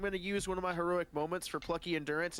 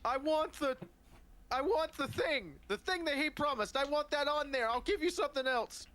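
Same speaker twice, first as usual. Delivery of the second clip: higher-pitched, pleading insistence; acted, worked-up haggling; insistent demand; 6 semitones higher than usual. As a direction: demand it like someone who won't be refused.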